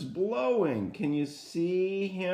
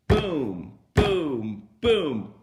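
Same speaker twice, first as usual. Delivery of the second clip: booming knock sounds, louder each time; loud door booms; 7 dB louder, much slower than usual. Acted. Storyteller to children, wolf banging on the straw house, loud booms. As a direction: pound out each boom like a fist on wood.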